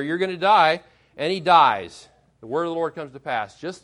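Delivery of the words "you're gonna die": "die" delivered louder and final; firm doom. Retold or imitated. Preacher voicing Elijah telling the king he'll die, louder. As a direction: land "You're gonna die" like a verdict.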